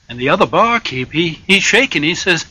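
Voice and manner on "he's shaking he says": ordinary delivery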